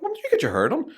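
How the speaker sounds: butter up your mom; high coaxing question; sweet-talking son. Retold or imitated. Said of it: "I went".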